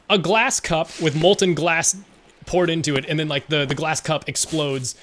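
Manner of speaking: usual speaking voice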